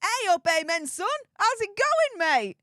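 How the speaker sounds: broad northern English hey up, played up for the viewer; cheery Yorkshire hello to a fan's son; hammed-up Yorkshire greeting. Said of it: "He loves your accent".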